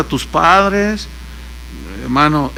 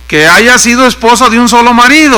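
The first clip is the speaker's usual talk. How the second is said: loud, raised proclamation; higher, emphatic reading voice; scripture reading; 4 semitones higher, 7 dB louder than usual. Real, not acted. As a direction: declaim the verse from the pulpit. Reads the verse aloud.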